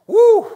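high, loud "woo"; sudden whoop. Acted. Preacher recalling hot wire fences, sudden whoop. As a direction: let out a sharp whoop as if zapped. Reacting to electric shock memory.

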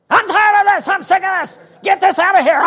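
ordering the sickness out in anger; frustrated shout. Retold or imitated. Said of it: "That's frustration".

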